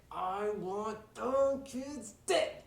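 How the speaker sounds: loud, high, drawn-out angry outburst; acted furious shout, every word stretched; furious boss. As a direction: roar it slowly as an enraged villain. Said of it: "furious. He's so upset".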